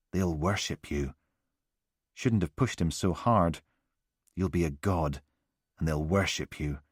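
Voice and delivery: lightly voiced menacing calm; creepy soothing. Killer's voice on recording.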